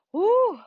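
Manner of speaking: high, loud whoop of relief; excited woo. Real, not acted. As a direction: cheer it out after the effort. Cheer after burning shoulder set.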